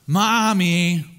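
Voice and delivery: lightly voiced small boy calling mommy; little boy voice. Son [name] calls his mother.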